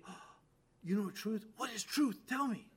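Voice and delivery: an eager seeker who wants the answer; lifted, curious and excited; eager, enthusiastic. Shown as a demonstration. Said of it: "said it enthusiastically".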